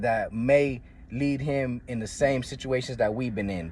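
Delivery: ordinary talk, nothing marked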